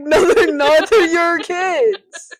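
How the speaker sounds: high, very loud, drawn-out retort; mock indignant yell. One father rejecting the other's kids, mock indignant yell. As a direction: yell it in mock outrage, stretching the end. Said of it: "And he'd be like".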